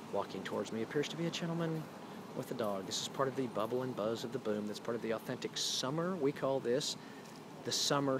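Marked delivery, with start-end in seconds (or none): 0.1-6.2 hushed, low noir narration; noir detective. Pretend. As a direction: tell it like a hard-boiled detective. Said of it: "sort of film noir style of telling the tale".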